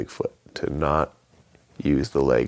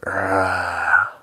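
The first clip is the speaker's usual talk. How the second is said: louder Bigfoot roar; creature roar; 5 semitones higher, 5 dB louder than usual. Acted. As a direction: roar to scare him off. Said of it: "the Bigfoot looked up and went".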